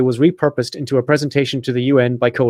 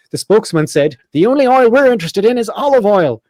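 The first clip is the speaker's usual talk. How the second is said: higher-pitched parody newsreader; mock news read; 4 semitones higher than usual. Host reads his satirical hummus article, mock news read. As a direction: read it like an earnest news bulletin.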